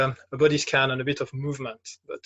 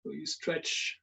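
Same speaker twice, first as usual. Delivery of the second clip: guided meditation; 4 dB quieter than usual. Acted. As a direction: guide them gently through a standing body scan, leaving long silences. Leads a standing meditation.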